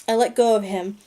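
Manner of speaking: ordinary delivery, nothing marked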